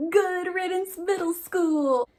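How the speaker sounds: high, slow diary title; reading her diary. Acted. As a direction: announce it like a triumph. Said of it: "the excerpt that I've chosen for you today says".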